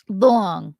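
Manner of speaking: "Long" with a drawn, rounded Long Island "aw"; Long Island accent. Shown as a demonstration. Asked by a chatter, Long Island accent on "Long". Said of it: "say long in your most Long Island accent".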